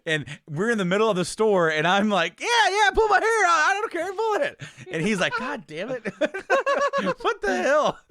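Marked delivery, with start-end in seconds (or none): 2.4-4.6 high, loud and eager, half laughing; eager kid. Retold or imitated. Boy urging dad in the store, high eager shout. 5.4-8.0 high, loud exasperation dissolving into laughter; laughing mock dad. Dad in Walmart, laughing mock exasperation.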